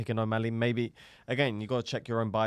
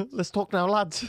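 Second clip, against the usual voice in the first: Paddy Pimblett; 10 semitones higher, 5 dB louder than usual. Acted. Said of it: "What does he say to him now?".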